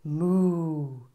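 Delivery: a low moo; cow's moo. Acted. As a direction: moo like a cow. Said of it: "said the cow".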